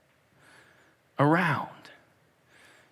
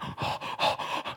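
acted breathless gasps; panicked gasp; 9 dB quieter than usual. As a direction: gasp like someone caught. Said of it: "she's probably leaving going".

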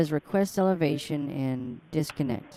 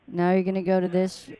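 normal talking voice

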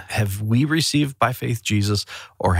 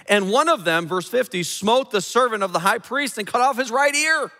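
raised, impassioned preacher voice; preaching; 12 semitones higher than usual. Acted. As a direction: preach it with fire from the pulpit. Preaching a Bible passage.

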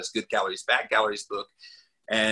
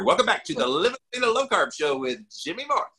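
higher, singsong radio show welcome; show intro; 8 semitones higher than usual. Acted. Host mocking his own repeated show opening, announcer-like. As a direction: put on your podcast host greeting. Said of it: "you had to hear".

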